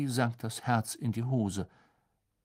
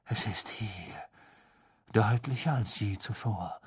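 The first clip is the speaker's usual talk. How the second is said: ominous whisper; 4 dB quieter than usual. Acted. Seer whispers ominous prophecy.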